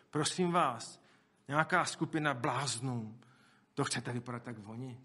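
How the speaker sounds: normal talking voice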